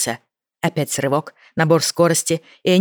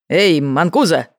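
loud, drawn-out call; 4 dB louder, slower than usual. A narrator's reading. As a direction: call him out loudly. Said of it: "громко сказал Джейк".

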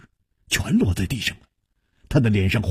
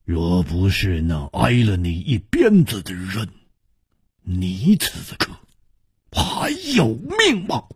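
cold; 4 semitones lower than usual. A narrator's reading. Swordsman rebuking the youth who whipped his rescuer, coldly. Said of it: "易点红冷冷地说".

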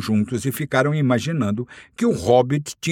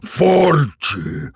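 higher, louder roar of Forte!; roaring boast; 7 semitones higher, 5 dB louder than usual. Acted. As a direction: bellow Forte! like a triumphant dragon. Dragon repeats it louder. (dragão rugindo Forte! mais alto)

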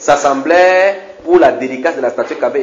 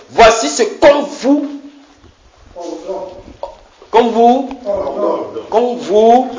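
herald's proclamation; 9 semitones higher, much slower than usual. Acted. Herald announcing the king's order to the officials, high ringing call. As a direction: ring it out like a royal herald.

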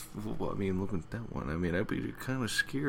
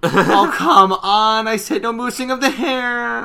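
much higher, much louder mock outrage; exasperated Rarity; 13 semitones higher, 12 dB louder, slower than usual. Rarity scolding the spider, high loud mock outrage. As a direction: shriek the complaint like a diva.

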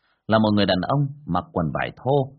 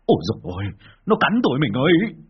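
loud, higher yelp of pain; howling cry; 5 semitones higher, 5 dB louder than usual. Acted. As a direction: howl out after being bitten. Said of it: "Bác Vó hú lên".

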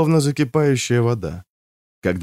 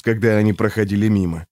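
ordinary delivery

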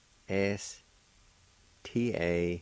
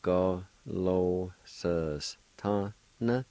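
slow model; much slower than usual. Shown as a demonstration. Teacher modelling Go lo sus tana slowly for learners. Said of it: "It's pronounced like this".

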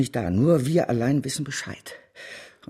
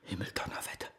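geflüstert; 5 semitones lower, 15 dB quieter, faster than usual. Acted. Fassungslos über die Nachricht.

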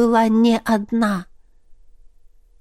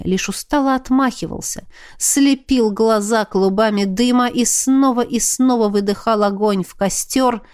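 usual speaking voice

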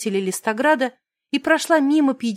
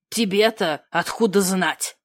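надменно; 5 semitones lower than usual. Acted. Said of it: "надменно приподнял брови Блашек".

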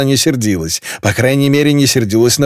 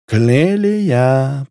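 sing-song; 2 semitones higher than usual. A narrator's reading. София teases Клелия before the guest, sing-song. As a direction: croon the name playfully. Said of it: "пропела София".

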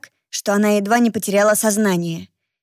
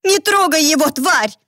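angry shout; 7 semitones higher, 3 dB louder than usual. Acted. Girl shouts at attacking vampire.

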